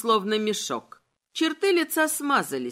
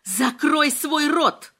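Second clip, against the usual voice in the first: slow, raised, forceful shout; shouted command; 4 semitones higher, 4 dB louder, slower than usual. A narrator's reading. Woman ordering him to shut his mouth, shouted. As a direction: shout it word by word. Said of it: "крикнула она".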